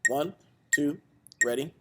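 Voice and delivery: ordinary talk, nothing marked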